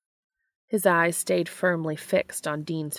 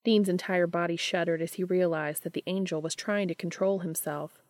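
ordinary delivery

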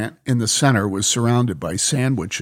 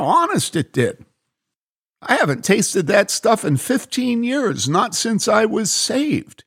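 much higher, earnest amazed man; Bob, insistent; 11 semitones higher than usual. Acted. Bob defends his story to the lady, earnest and excited. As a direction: swear it's true.

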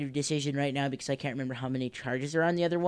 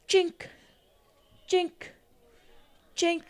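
imitated hammer clink; slower than usual. Game master sounding the blacksmith's forge, ringing clinks. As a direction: mimic a hammer striking at a forge.

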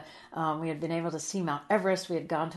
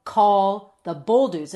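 loud, slow, word-by-word quote; nagging son, slow and emphatic; insistent repeated demand; 11 dB louder, much slower than usual. Retold or imitated. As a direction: spell out the order forcefully, word by word.

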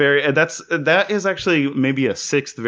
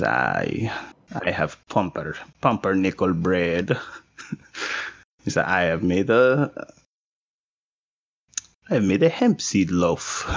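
put-on German accent; 4 semitones lower than usual. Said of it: "this guy's German now".

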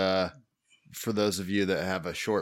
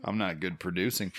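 ordinary talk

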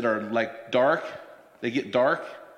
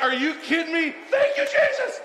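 excited relief; 11 semitones higher than usual. Retold or imitated. Relieved joy at coffee answer.

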